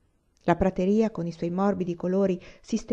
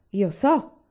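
proud, louder "io so!"; a touch of a proud old Indian; proud exclamation; 4 dB louder than usual. A narrator's reading. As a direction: claim the knowledge with pride. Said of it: "esclamò orgogliosamente".

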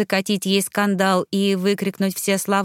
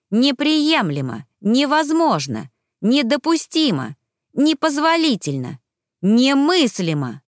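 indignant emphasis; 5 semitones higher, 3 dB louder than usual. Acted. Indignant list of better words.

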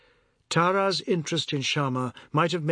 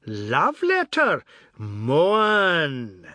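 slow, louder shriek of glee on the name; teasing shriek; 4 dB louder, much slower than usual. A narrator's reading. Ajoda teasing Mohan about the love letter, gleeful shriek. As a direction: stretch it out, crowing. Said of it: "Ajoda shrieked again".

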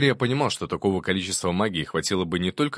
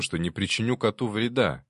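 ordinary delivery, nothing marked

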